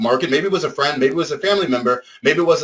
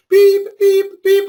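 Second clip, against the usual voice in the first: high, loud mimicked backup beeps; imitated beeping; 7 dB louder, much slower than usual. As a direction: beep like a tow truck reversing, pitched way up. Mimicking tow truck backup alarm.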